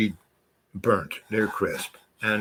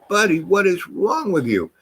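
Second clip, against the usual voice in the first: exasperated; 9 semitones higher than usual. Retold or imitated. Exasperated at screaming passenger.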